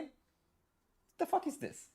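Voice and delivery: high, slow, strained disbelief; incredulous. Acted. Streamer ranting at a lady gamer's half-naked pictures, high incredulous whine. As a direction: stretch it out high in outrage.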